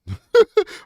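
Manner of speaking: high, loud burst of laughter; real laugh at his own question; amused laugh. Real, not acted.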